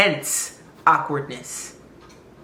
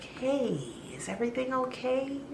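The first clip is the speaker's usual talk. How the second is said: higher and much softer, tentative; gentle check-in; 7 semitones higher, 11 dB quieter than usual. Retold or imitated. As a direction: ask softly through the door. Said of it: "I knocked and I was like".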